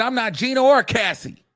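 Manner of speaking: high, sassy and defiant; sassy diva. Retold or imitated. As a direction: toss her head at the rivals. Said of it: "She said".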